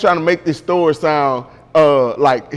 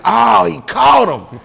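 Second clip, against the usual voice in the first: excited shout; slower than usual. Retold or imitated. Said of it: "I'm like".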